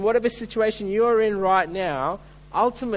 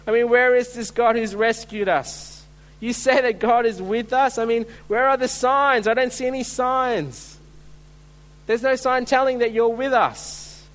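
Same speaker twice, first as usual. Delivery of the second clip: raised, higher-pitched complaint; indignant challenge; 4 semitones higher, 4 dB louder than usual. Acted. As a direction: demand answers, pressing and exasperated. Voicing Gideon challenging the angel.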